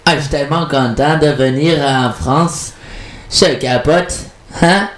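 imitated Québécois French accent. Shown as a demonstration. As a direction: put on a Québécois accent for a French radio host. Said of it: "je sais très bien faire l'accent québécois".

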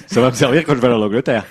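talking through laughter; quick, amused, laughing. Real, not acted. Joking about his 3G phone.

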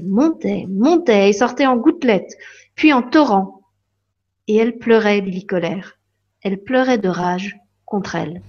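ordinary delivery, nothing marked

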